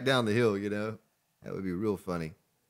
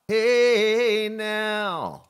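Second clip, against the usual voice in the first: mock warning; 8 semitones higher, 6 dB louder than usual. Calling at zombie.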